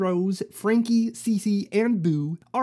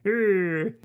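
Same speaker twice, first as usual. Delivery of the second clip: ordinary delivery, nothing marked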